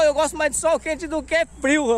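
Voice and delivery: ordinary talk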